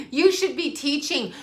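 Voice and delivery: high laugh through the quote. Real, not acted. Quoting a viewer, laughing high. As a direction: giggle through you should be teaching.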